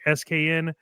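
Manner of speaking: ordinary talk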